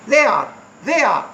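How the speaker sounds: "they are" as a US-accent model. Shown as a demonstration. Teacher shows the USA version, US-accent "they are". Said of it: "ये USA accent है".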